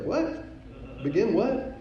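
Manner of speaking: high, rising, incredulous question; taken aback. Retold or imitated. Startled reply in retold story.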